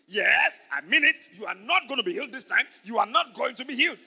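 acted enraged insistence; angry Satan. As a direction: hammer it out as a furious devil. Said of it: "Satan got so angry".

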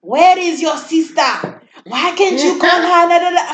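mock-demand where the sister is; impatient mom imitation. Imitating mom wanting the baby gone at night, loud and demanding. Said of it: "when it comes up to she sleeps".

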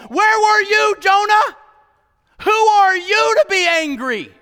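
high, loud, building to a shout on Jonah; thundering rebuke. Acted. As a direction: preach God's challenge full force. Preaching God's rebuke at full force.

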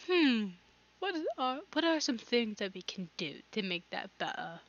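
teacher voice. Retold or imitated. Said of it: "Then she asked".

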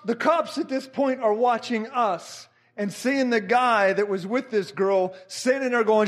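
ordinary talk

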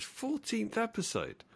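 high, soft Kermit-style squeak. Pretend. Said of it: "wave my hands like Kermit".

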